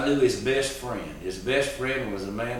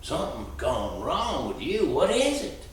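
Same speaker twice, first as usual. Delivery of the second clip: put-on gentle old man; 4 semitones higher than usual. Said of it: "whispered in my ear".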